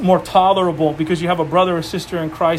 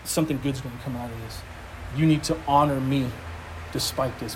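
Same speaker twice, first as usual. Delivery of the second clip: a hint of a calm inner voice, softer and quick; the Lord speaking; 5 semitones lower, 8 dB quieter than usual. Pastor retelling what the Lord told him, softly and quickly. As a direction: let it drop soft as if God is speaking to you. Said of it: "the Lord's like".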